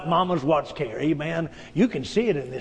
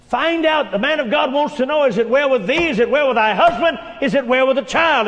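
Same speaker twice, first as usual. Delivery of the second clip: louder, higher pressing asks; urgent questions; 5 semitones higher, 4 dB louder than usual. Acted. Man of God sending servant to ask, urgent. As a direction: press for news of the family.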